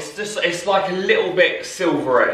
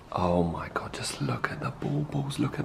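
softer, hushed whisper; awed whisper; 3 semitones lower, 11 dB quieter than usual. Real, not acted. Admiring the finished decorated tree in a hushed whisper. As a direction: breathe it in awe.

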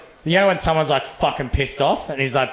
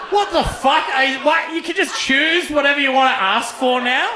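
high, laughing amazement; incredulous and cracking up, pitched high; laughing disbelief; amazed; 13 semitones higher than usual. Real, not acted.

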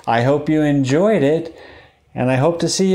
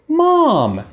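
high, eager cry for mom; excited call; 13 semitones higher, 3 dB louder than usual. Acted. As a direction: call out with sudden hope. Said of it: "he quacked loudly".

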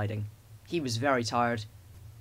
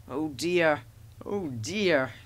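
lightly yawned, drawn-out sigh of words; yawning; much slower than usual. A narrator's reading. Thomas starting late in the morning, mild yawn. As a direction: suggest a sleepy yawn. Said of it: "he yawned".

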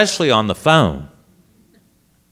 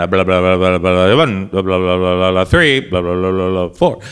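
lower, fast-running auctioneer patter; mock auctioneer babble; 8 semitones lower than usual. Priest imitates the auctioneer heard over the phone, mock patter. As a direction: rattle off the blahs like a distant auctioneer. Mimicking auctioneer's background chatter.

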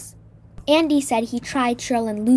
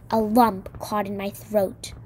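usual speaking voice

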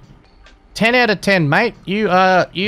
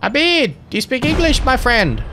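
calling out; 4 semitones higher than usual. Real, not acted. Calls out to the cheater.

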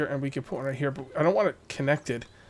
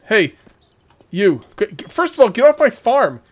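much higher, louder angry shout; yelling at villager; 7 semitones higher, 10 dB louder than usual. Real, not acted. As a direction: chase her off the farm. Yelling at villager on his farm.

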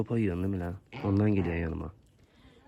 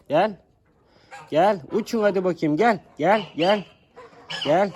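high, loud repeated calls; loud high shouts of come; calling the flock; 10 semitones higher, 6 dB louder, slower than usual. Real, not acted. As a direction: call them home from a distance.

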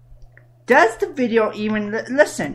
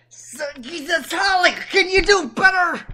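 a hint of an old New York lady; 7 semitones higher than usual. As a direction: play a fussy old lady. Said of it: "this is an old lady".

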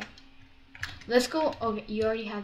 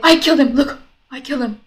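excited; 3 semitones higher, 15 dB louder than usual. Real, not acted. Bragging after kill.

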